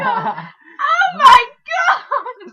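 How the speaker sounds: loud high drawn-out shocked cry; shocked shout. Retold or imitated. Boaters shouting at what they saw in the water. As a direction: cry out in disgust. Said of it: "they go".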